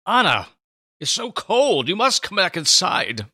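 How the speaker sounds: calling out high and urgent; worried call. Acted. Old Simeon calls Anna in from the cold, urgent and pleading. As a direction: call her back in from the cold. Simeon urging her inside.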